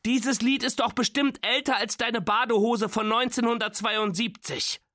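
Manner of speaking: high-pitched teasing complaint; exasperated, high mocking jab; mocking protest. A narrator's reading. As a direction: keep the pitch high throughout, needling grandpa. Nora mocks grandpa's old song.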